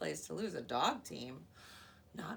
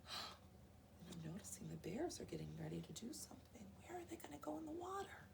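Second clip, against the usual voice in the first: whispered aside; 4 semitones higher, 9 dB quieter than usual. Real, not acted. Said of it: "reader whispers to children".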